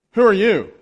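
loud, high challenge; commanding stranger. Retold or imitated. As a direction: imitate a man demanding answers with authority. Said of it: "his authority just kinda knocked me over".

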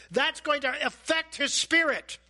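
ordinary talk, nothing marked

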